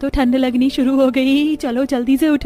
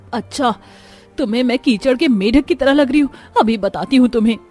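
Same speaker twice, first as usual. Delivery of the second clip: ordinary delivery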